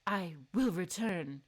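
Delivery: a hint of a brooding hero; solemn Sebastian. Sebastian's line read as character.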